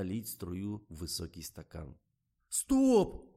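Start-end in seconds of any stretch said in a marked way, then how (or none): 2.5-3.4 very high, loud squeal; shriek. A narrator's reading. Zhorik halts the filming, shrieking. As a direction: squeal it to halt everything. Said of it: "взвизгнул Жорик".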